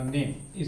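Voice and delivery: नेम as one syllable, silent final e; सही उच्चारण. Shown as a demonstration. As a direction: say नेम ending on the m. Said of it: "इसका pronunciation correct ही करना है". (नेम, आख़िरी ई के बिना)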